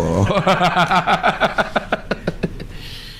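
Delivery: high, staccato run of laughter; long laugh. Real, not acted. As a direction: laugh until it tails off. Amused after mock dismay. (tawa panjang terkekeh-kekeh)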